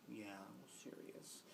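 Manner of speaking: hushed whisper. Retold or imitated. Sheepish child admitting it to the aunt, whispered.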